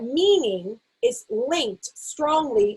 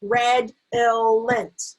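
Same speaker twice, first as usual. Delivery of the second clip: redolent slowly split into three syllables, red-ill-ent; very slow, separate beats: red, ill, ent; tapped-out syllables; much slower than usual. Shown as a demonstration. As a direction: tap out each syllable of redolent. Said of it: "tap the syllables".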